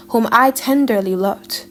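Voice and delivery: usual speaking voice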